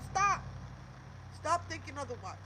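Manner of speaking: loud, high urgent order; sharp command. Real, not acted. Woman urging her viewers not to doubt, sharp command.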